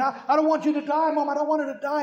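put-on retelling a boy begging for his mom; worked-up pleading child. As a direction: cry out like a frightened boy. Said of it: "got all worked up again".

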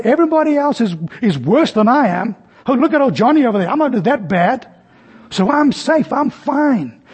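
high, smug, self-satisfied; mocking the smug sinner who feels safe; smug self-assurance. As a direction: brush off guilt with mock confidence.

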